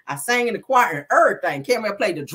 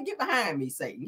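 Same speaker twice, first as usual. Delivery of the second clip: softer, restrained demonstration; toned-down rebuke, quiet at the end; proper church rebuke; 6 dB quieter than usual. Shown as a demonstration. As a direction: show the polite way, falling away on "Satan". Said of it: "Just say".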